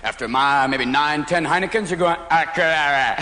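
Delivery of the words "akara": put-on slurred drunk nonsense word; mock drunk babble; drunken gibberish. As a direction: slur it like a drunk. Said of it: "You're speaking fluent drunkenese".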